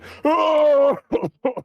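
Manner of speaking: long, high comic sob; loud hammed-up bawling into a handkerchief; mock blubbering wail. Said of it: "it's drenched at this point".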